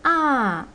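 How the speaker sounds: "a" starting high and falling; fourth tone. Shown as a demonstration. Teacher modelling for repetition, falling "a". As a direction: drop "a" from high to down. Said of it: "from high to down".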